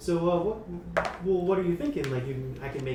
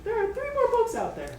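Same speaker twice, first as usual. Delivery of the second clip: robot owl voice. Acted. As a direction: speak like a chirpy scanning robot owl.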